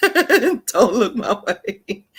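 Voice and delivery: high, slow words through real laughter; laughing. Real, not acted. Joking about giving silent treatment, laughing. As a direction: crack up while repeating yourself.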